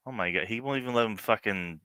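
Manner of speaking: normal talking voice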